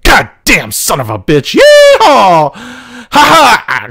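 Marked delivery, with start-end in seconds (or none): 1.5-2.5 loud, high rodeo holler; cowboy yeehaw. Acted. Buzzing the tower. 2.5-3.9 gleeful laugh. Acted. Laughing during flyby.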